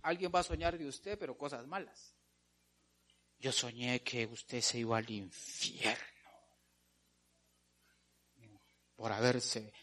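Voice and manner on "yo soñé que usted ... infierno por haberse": voz baja y grave de falso agorero; agorero imitado. Acted. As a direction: imita un augurio solemne, bajito y grave. Imita al que cuenta su sueño. (voz de mal agüero, baja y grave)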